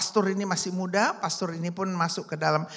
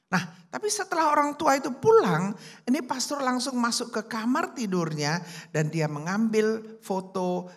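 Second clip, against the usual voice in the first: ordinary talk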